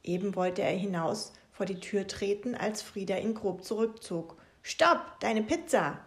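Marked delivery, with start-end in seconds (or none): 4.6-6.0 alarmed shout. Acted. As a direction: stop him in his tracks. Frieda yanks Maxe back.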